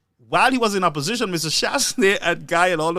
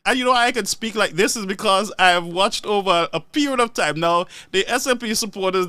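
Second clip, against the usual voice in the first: ordinary talk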